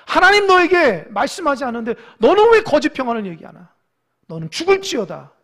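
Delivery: building to loud, thundering commands; angry divine rebuke. Acted. God sending the prophet against the liar, angry rebuke. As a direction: pronounce it as a wrathful sentence. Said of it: "하나님 화가 났어요".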